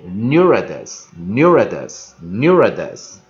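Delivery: "neuritis" slow and loud, three times; pronunciation drill. Shown as a demonstration. Teacher repeats a word that is hard to read, pronunciation drill. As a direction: model "neuritis" firmly for learners to copy. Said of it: "这个念起来比较".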